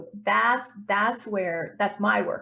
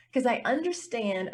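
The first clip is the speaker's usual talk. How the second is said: normal talking voice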